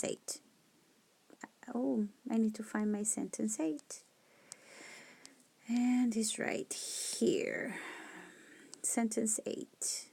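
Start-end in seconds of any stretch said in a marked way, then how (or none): 1.7-10.1 whispered aside to herself. Real, not acted. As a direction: murmur under her breath while searching.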